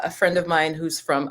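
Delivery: ordinary talk, nothing marked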